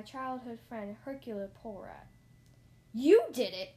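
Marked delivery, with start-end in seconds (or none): 2.9-3.7 very loud shocked accusation; outraged, swooping cry; exclaiming. A narrator's reading. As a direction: blurt it out at full volume. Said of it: "I exclaimed".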